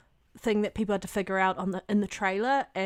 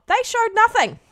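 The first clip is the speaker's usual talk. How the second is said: exasperated; 13 semitones higher, 9 dB louder than usual. Real, not acted. Exasperated rant about trailer.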